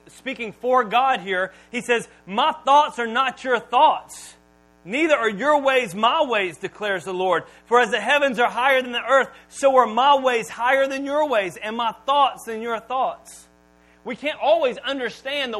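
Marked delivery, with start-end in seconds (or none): 2.2-13.4 preaching at full force. Acted. Preacher booming scripture to the congregation. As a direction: proclaim it to the room. Preacher reading God's words.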